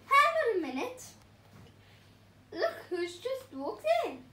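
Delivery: high, loud surprised call; hammed-up surprise; surprised pineapple. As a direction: point out the newcomer with a start. Pineapple spotting someone.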